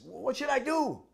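high, loud, flustered ask; a soldier asks the monk what to do, high and excited; eager question. Retold or imitated.

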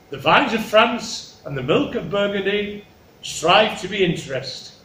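lilting, stately royal declamation; grand kingly talk. Acted. King naming his youngest's suitors, stately and lilting. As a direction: roll out the ornate phrases ceremoniously. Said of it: "that's the way he talks".

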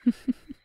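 short satisfied laugh; pleased witch chuckle. Acted. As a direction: laugh as the deal is sealed. Deal sealed, witch pleased.

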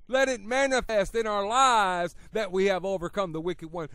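rising fervour of a revival preacher; full-force preaching. Acted. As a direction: preach it hard to the congregation.

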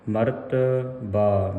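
ਮਰਤਬਾ slowly, with ਬਾ set slightly apart; slow model of ਮਰਤਬਾ, long final ਬਾ; correct pronunciation. Shown as a demonstration. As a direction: say ਮਰਤਬਾ as ਮਰਤ-ਬਾ, linked but distinct. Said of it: "ਸ਼ੁੱਧ ਉਚਾਰਨ ਹੈ".

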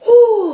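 high loud whew, blowing out heat; relieved whew. Real, not acted. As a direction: blow out the burn loudly. Exhale after spicy sauce.

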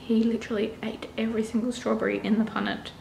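fast soft whisper. Real, not acted. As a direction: whisper so you don't wake him. Toddler asleep at home.

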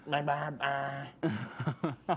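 loose imitation of a Southern drawl; trying to recall the Vine kid's Southern voice; faint Southern twang. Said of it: "that really southern twang".

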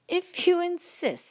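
high, slow, lilting hyena reply; lightly voiced airy hyena. A narrator's reading. Hyena accepting a fight, a hint of airiness. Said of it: "laughing airily and showing its teeth".

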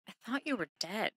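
put-on very soft, small child's confession; hushed, understated hurt; imitated tearful child. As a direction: quietly imitate a child in tears. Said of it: "bursts into tears".

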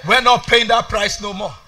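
ordinary talk

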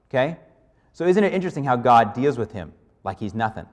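usual speaking voice

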